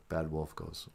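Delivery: normal talking voice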